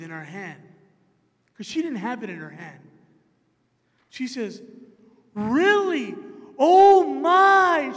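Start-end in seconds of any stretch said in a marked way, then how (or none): 5.3-7.9 gasping, loud delighted surprise; a stranger thrilled at a matching birthday; so excited. Retold or imitated. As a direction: retell her thrilled reaction, high and loud. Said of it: "She got so excited".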